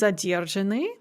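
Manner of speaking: задержаны stressed on the second syllable, дер; shifted stress. Shown as a demonstration. Teacher reviewing the stress shift, дер stressed in задержаны. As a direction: move the stress of задержаны back onto дер. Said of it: "shift one syllable to the front".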